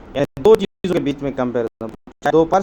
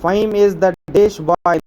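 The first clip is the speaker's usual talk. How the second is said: slow dictation; 3 semitones higher, 3 dB louder, much slower than usual. Shown as a demonstration. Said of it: "reads blank exercise sentence slowly".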